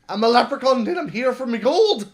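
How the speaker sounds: high, loud mock leprechaun; a hammed-up little leprechaun demanding his gold. Leprechaun announces he has come for his gold, high and loud. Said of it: "He's like".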